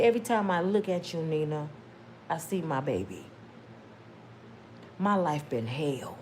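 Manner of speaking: low, soft heartbroken aunt; auntie voice. Retold or imitated. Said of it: "I'm my auntie voice".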